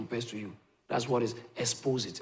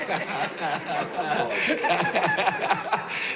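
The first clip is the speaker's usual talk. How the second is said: real laughter; 3 semitones higher than usual. Real, not acted. Preacher laughs with congregation.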